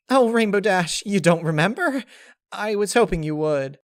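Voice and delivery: very high, loud, bubbly pony voice; Pinkie Pie voice. Acted. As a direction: gush with teary joy.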